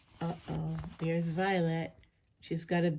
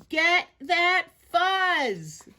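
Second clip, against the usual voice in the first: high, loud and very slow yell; slow-motion charge shout; drawn-out battle cry; 10 semitones higher, 5 dB louder, much slower than usual. Acted. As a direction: stretch every word into a long war cry. Fuzz fight breaks out.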